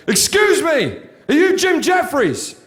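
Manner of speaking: eager soldier checking who I am; soldier asking. Retold or imitated. Soldier politely asks the comedian his name. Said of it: "this soldier runs off and goes".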